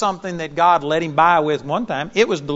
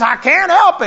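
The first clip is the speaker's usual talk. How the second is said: imitating protest; 10 semitones higher, 8 dB louder than usual. Quoting the woman's protest.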